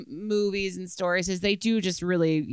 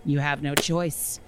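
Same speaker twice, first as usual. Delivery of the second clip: usual speaking voice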